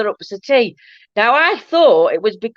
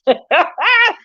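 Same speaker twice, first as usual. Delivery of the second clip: high burst of laughter; real amused cackle; laughing; 10 semitones higher than usual. Real, not acted. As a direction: crack up at your own line. Laughing at her accent boast.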